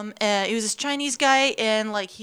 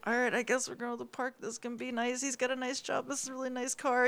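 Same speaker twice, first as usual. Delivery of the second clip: hushed inner voice; 13 dB quieter, faster than usual. Retold or imitated. Retold hushed inner resignation.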